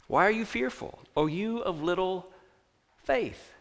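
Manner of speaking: soft, hushed chiding, spaced out; gentle rebuke. Acted. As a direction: say it quietly and tenderly, pausing before the last word. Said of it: "I imagine Jesus again saying".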